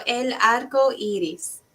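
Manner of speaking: "el arco iris" with a tapped Spanish r, stress on ARco; Spanish pronunciation. Shown as a demonstration. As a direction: model "el arco iris" for the kids to copy. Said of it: "how to say rainbow in Spanish".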